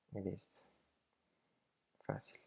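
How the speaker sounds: breathy whisper of fácil; a quick hushed easy; whispered aside. Real, not acted. Whispering fácil to the class as an aside. Teacher's aside after the lesson.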